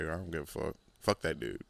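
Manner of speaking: low, soft dismissive mumble. Retold or imitated. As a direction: shrug it off under your breath. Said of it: "Some of us are just like".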